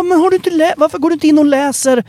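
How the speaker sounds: mock the commenter, fed up; exasperated mock retort. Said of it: "man bara". (spelat irriterad, mycket högre röst)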